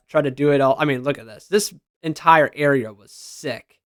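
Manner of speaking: normal talking voice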